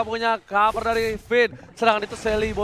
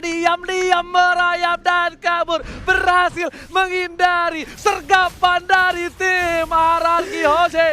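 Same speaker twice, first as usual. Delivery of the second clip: excited; 6 semitones higher, 4 dB louder than usual. Real, not acted. Hero escapes, caster hypes it.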